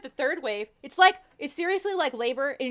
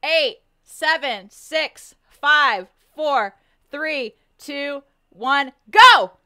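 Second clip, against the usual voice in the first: countdown call; 8 dB louder, much slower than usual. Real, not acted. Group countdown before the challenge.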